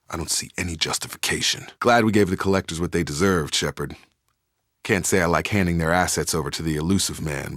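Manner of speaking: ordinary talk, nothing marked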